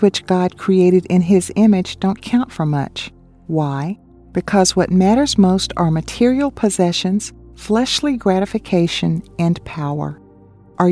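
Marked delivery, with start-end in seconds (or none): none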